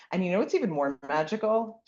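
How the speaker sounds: ordinary talk, nothing marked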